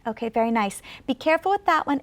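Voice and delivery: ordinary talk, nothing marked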